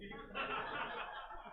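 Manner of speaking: short airy laugh at his own line; high unvoiced laugh; breathy laugh. Real, not acted. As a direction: let a quick breathy chuckle out.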